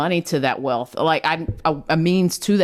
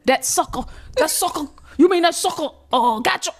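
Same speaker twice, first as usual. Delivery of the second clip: high, incredulous dad voice; much higher-pitched mimicry of an outraged father; daddy impression; 12 semitones higher than usual. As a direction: imitate dad worked up over the news. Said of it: "Say it in his voice".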